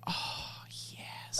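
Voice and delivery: long, breathed-out whisper; hushed, blissful reaction; whispered delight. Retold or imitated. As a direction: savor it in a whisper. Retold blissful reaction to Leia's name.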